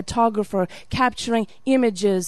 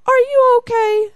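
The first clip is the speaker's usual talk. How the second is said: much higher, louder put-on officer voice; concerned officer; 14 semitones higher, 6 dB louder than usual. Security agent checks on weeping traveler, high concerned voice. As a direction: imitate him calling out in high-pitched concern.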